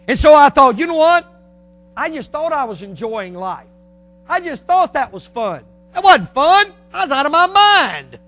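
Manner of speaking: loud, high-pitched pulpit testimony; full-force preaching. Acted.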